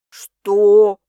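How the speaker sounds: sudden loud startled question. A narrator's reading. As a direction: blurt it out in relief. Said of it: "слегка повысил голос".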